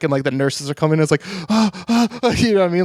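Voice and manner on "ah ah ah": high gasping ahs; breathless panicky gasps; mock panicked gasps. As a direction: imitate an anxiety attack gasp. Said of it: "an anxiety attack".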